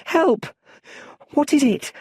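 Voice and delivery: high, loud scared shout; a boy panicking in the dark; frightened child. Acted. Young Bruce hearing strange sounds, shouting high and scared.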